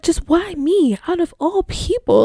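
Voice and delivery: high, hammed-up woe-is-me; mock lament. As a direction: play it as a dramatic sigh to the sky. Retelling lament to random person.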